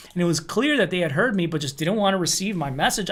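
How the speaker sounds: usual speaking voice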